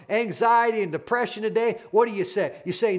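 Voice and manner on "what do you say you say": ordinary talk, nothing marked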